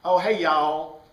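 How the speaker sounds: quick imitation of a Southern drawl greeting; Southern accent. Teacher showing the class a Southern greeting, mock Southern accent. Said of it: "or you go to the South".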